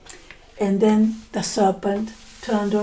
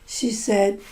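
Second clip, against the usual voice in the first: ordinary delivery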